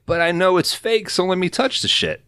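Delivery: whiny high begging; Trelawney pleads to touch the scepter, high pleading voice. Retold or imitated.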